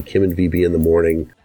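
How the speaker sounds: ordinary talk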